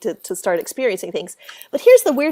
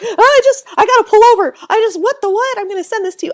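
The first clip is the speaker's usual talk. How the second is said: much higher and louder, bursting out; excited disbelief; 11 semitones higher, 7 dB louder, faster than usual. Retold or imitated. Becky pulling over after hearing the tape, excited. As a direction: gush in shocked excitement. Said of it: "She was like".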